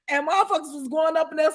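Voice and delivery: usual speaking voice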